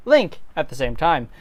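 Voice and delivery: ordinary talk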